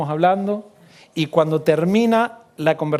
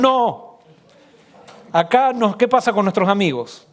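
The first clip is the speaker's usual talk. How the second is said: mildly dismayed protest, pitched higher; retold protest; 4 semitones higher than usual. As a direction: imitate kids objecting, voice lifted at first. Children's shocked protest, retold. (protesta imitada de los chicos)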